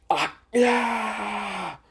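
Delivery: high, strained cries of pain; hammed-up electrocuted yells; pained crying out. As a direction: cry out while being zapped. Jedi being electrocuted.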